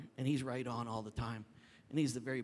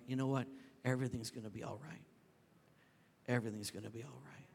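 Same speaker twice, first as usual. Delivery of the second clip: soft, low comfort; reassuring; 3 semitones lower, 5 dB quieter, faster than usual. Retold or imitated. Pastor giving the Father's message to the needy, reassuring. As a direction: settle them with quiet assurance.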